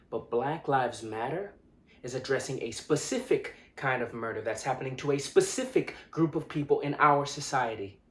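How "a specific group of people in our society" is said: loud, raised-pitch confrontation; heated, insistent and louder; impassioned rebuke. Acted. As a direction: tell them off forcefully.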